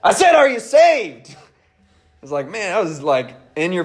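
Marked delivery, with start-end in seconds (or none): 0.0-1.4 intense soldier. Retold or imitated. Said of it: "He said".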